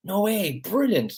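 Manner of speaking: high, thrilled squeal of delight; mock-ecstatic reaction. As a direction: gush like you just heard great news. Excited reaction to ice bath news.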